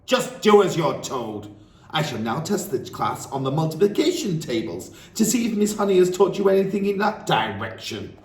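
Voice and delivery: high, loud and contemptuous; bullying headmistress. Acted. Headmistress mocking Miss Honey before the class, loud. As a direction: sneer at the teacher's work.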